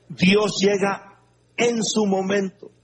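ordinary delivery

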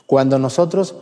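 normal talking voice

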